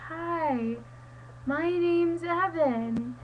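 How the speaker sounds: stretched-out singsong hello; mock introduction. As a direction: imitate someone introducing himself, slowly. Imagined self-introduction, quoted from teacher.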